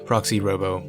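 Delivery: ordinary talk, nothing marked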